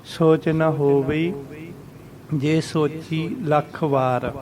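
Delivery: slow recital with drawn-out vowels; measured recitation. Shown as a demonstration. Teacher reciting scripture for learners, slow and measured. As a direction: recite slowly, pausing between phrases. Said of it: "ਇਸ ਤਰ੍ਹਾਂ ਉਚਾਰਨ ਕਰਨਾ ਇਹਦਾ".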